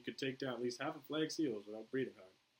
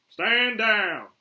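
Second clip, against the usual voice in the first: loud, raised military order; shouted command; 12 semitones higher, 17 dB louder than usual. Acted. Flag orders the sharpshooters off, loud firm command. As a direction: call it out with authority.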